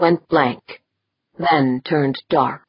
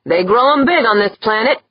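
grudging admiration; 6 semitones higher, 4 dB louder than usual. A narrator's reading. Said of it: "with grudging admiration".

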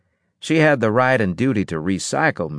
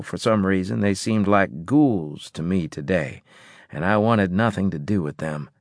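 ordinary delivery

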